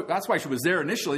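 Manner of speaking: ordinary talk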